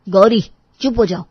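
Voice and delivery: loud, angry silencing; sharp rebuke. Acted. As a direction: cut her off with a loud reprimand. पति पत्नी को चुप कराता है. (गुस्से भरी तेज़ फटकार)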